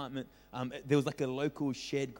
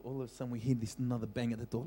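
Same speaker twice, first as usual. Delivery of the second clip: ordinary talk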